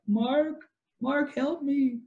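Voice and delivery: high, slow damsel voice; imitated moaning plea. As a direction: plead weakly in a put-on high voice. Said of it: "like, moaning".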